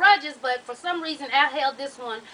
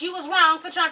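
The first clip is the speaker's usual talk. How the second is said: normal talking voice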